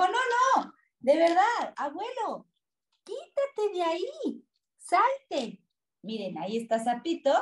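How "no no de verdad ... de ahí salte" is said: put-on high-pitched urgent child-like plea; raised storybook voice, insisting; pleading little toad. A narrator's reading. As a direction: beg grandpa in a high, lightly acted voice. Little toad urging grandpa away.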